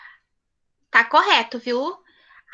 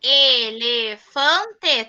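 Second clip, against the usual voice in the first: slow repeat; 2 semitones lower, much slower than usual. Shown as a demonstration. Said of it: "teacher repeats word slowly".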